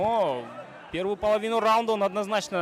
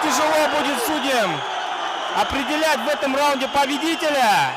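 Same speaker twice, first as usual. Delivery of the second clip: excited commentary; 5 semitones higher than usual. Real, not acted. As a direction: keep the tension up through the bell. End of round drama. (взволнованный комментарий)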